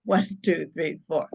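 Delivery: low, even count with spaces between numbers; measured counting. Shown as a demonstration. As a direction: count them calmly through the breath. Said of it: "and I'll go".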